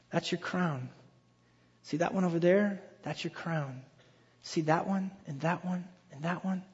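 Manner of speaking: soft, measured, letting each one land; quiet voice of God showing someone their reward; hushed, tender pointing-out. Acted. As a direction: softly point them out one by one. God pointing out people to Paul.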